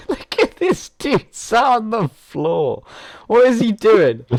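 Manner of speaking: high, slow laughing amazement. Real, not acted. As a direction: crack up while asking. Laughing at an avatar on floor.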